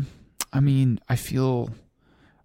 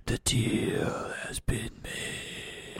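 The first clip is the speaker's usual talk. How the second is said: slow, eerie whisper; ominous whisper; 10 dB quieter, much slower than usual. Acted. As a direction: seal the pact in a whisper.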